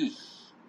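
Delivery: whispered slow "ich", ch only a soft hiss. Shown as a demonstration. Teacher slowly whispering "ich" so the student hears the soft ch. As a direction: sigh the ch of "ich" gently. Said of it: "একটু soft হবে".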